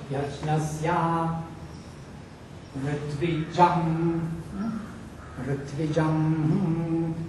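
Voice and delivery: slow Taittiriya reading of Yajnasya and Ritvijam. Shown as a demonstration. Teacher demonstrates Yajnasya and Ritvijam the Taittiriya way. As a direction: draw out Yajnasya and Ritvijam slowly with the Vedic accent. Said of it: "This is Taittiriya reading".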